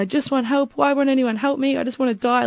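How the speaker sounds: high-pitched plea at everyday volume; retold hysterical screaming. Pleading with partner over the phone, retold hysterics. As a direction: imitate a desperate wail, pitched high. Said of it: "like, screaming on the phone".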